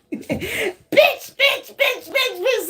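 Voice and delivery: high-pitched mock rant, laughing at the end; hammed-up high tantrum; mad person ranting. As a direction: squeal one curse over and over. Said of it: "Somebody is so mad".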